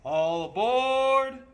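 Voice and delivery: booming all aboard; conductor's call. Acted. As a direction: sing it out down the platform. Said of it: "the conductor cried out".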